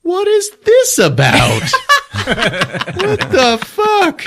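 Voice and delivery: very high, slow laughing protest; laughing disbelief. Retold or imitated. Podcaster voicing Grease Monkey confused, laughing and squeaky. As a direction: squeak it out while cracking up. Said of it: "Grease Monkey goes".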